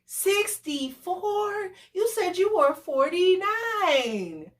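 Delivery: high, slow, stunned Sixty-four; shocked disbelief. Retold or imitated. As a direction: drag it out in disbelief. Learning his real age.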